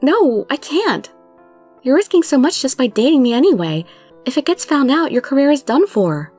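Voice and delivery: agitated protest. Acted. Heroine pleads in heated argument.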